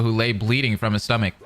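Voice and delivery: usual speaking voice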